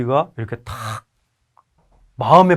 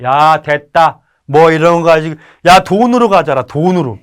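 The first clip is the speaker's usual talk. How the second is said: put-on gruff old parent; 2 semitones higher, 8 dB louder than usual. Elderly parent refusing gift.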